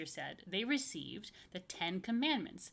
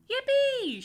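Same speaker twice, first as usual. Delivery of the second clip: very high, loud cheer; shouted yippee; 13 dB louder than usual. Acted. Michael cheering about no rules, very high and loud. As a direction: cheer like a thrilled kid. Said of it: "shouted Michael".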